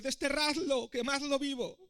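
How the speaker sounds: high, loud mob cry. A narrator's reading. Crowd demanding the prince's punishment, loud shouting. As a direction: yell it like an angry crowd. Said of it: "gritaban más fuerte".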